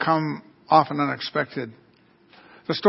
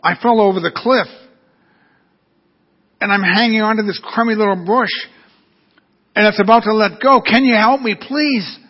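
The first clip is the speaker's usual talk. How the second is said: higher voice climbing into a pleading please; acted desperate plea; 5 semitones higher, 4 dB louder than usual. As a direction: beg for rescue, building in pitch. Hanging woman begs for help.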